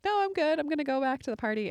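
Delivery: high, light brush-off, then brisk; chirpy no-thanks to wave him off; mock-cheery decline; breezy refusal. Retelling turning down his offer.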